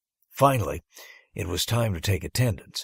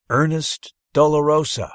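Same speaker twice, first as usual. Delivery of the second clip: teacher calling a name loudly and higher; 7 semitones higher, 8 dB louder than usual. Acted. Teacher calling the roll to her third-grade class. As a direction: call the name out across the classroom. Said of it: "called out Miss Paula".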